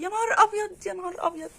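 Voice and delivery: high, loud burst of shock; surprised outcry. Real, not acted. Owner shocked to see another kitten, crying out. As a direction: blurt it out, stunned.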